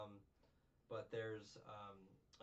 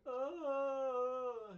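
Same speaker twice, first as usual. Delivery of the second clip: long, loud high-pitched "oh"; pathetic wail; 13 dB louder than usual. Retold or imitated. Kid bungles his boxing move, pathetic wail. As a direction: let out a pathetic, drawn-out high cry. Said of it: "that was so pathetic".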